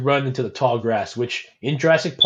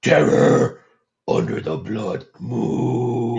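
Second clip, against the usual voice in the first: slow, drawn-out caveman growl; much slower than usual. Pretend. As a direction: say it like a dumb brute caveman. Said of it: "Say that in caveman voice".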